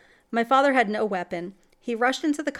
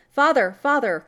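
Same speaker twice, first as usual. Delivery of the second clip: raised, loud, frightened calling; urgent cry; 5 semitones higher, 8 dB louder than usual. A narrator's reading. Boy wakes his father in terror at night. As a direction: wake him in a panic. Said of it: "cried I".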